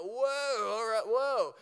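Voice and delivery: high, taken-aback whoa, pulling back; startled whoa. Retold or imitated. Couple reacting to the gossiping agent, taken aback. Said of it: "And we go".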